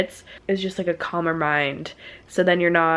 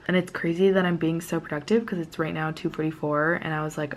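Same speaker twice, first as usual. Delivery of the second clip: normal talking voice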